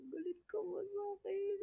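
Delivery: high, soft retold question; timid question. Asking her friend if she is mad, timidly. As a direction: ask it small and high. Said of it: "Sabi ko".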